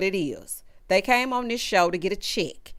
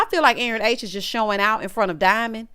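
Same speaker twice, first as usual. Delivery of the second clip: ordinary talk, nothing marked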